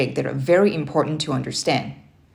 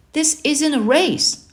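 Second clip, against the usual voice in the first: higher, slow whiny protest; complaining; 10 semitones higher, 3 dB louder, slower than usual. A narrator's reading. Boy protesting the timed flashcards, complaining. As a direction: push back with a whine. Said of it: "I complained".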